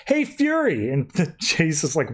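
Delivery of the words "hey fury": high, bright call across the room. Retold or imitated. Val Zod cheerfully calls over to Fury. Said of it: "Val Zod's like".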